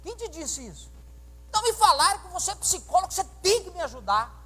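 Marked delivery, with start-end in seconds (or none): 1.5-4.3 put-on a young man demanding help, pushy and higher; mock threatening demand. A young man pressing the pastor with a threat in his voice. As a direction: push the demand on him like a threat. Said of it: "nesse tom de ameaça".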